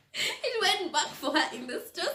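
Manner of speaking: high voice full of laughter; laughing. Real, not acted. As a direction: crack up while telling it. Laughs at the dog's move.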